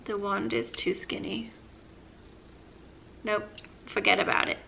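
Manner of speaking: disappointed whisper. Real, not acted. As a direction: murmur it under your breath. Disappointed aside on lip gloss.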